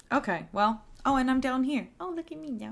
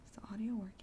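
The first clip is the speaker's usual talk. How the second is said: whispering; 2 semitones higher, 11 dB quieter, much faster than usual. Real, not acted. Checking stream audio mid-game.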